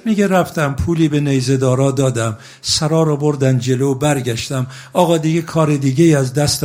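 high, loud rowzeh storytelling; impassioned preaching. Acted. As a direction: preach it out loud, pulling the crowd into grief. Preaching the story at full force.

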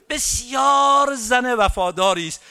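high, loud, tearful mourning cry; preacher's climactic lament for Rabab; wailing lament. Acted. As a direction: let the grief break out in the voice.

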